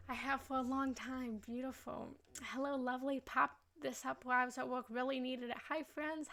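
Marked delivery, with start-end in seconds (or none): none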